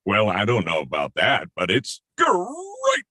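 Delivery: Tony the Tiger. Acted. Asked for a voice, he gives the cereal tiger's catchphrase. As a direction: boom it out like the cereal mascot. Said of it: "Can, can we hear a voice?".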